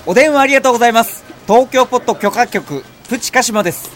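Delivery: bright, quick phone-reception greeting; customer service voice. Acted. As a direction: answer the phone like a cheerful receptionist. Phone answering skit.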